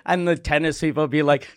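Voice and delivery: ordinary talk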